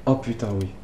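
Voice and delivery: ordinary delivery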